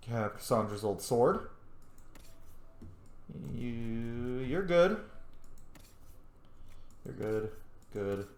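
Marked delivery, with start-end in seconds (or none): none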